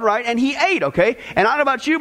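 usual speaking voice

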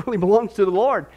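ordinary talk, nothing marked

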